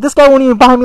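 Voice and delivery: ordinary talk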